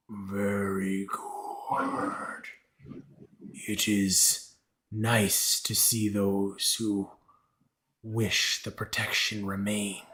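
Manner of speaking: low, very slow, drawn-out creature voice; imperious creature lord. Acted. Creature lord granting protection for gifts, imperious and slow. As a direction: speak slowly and low, weighing every word.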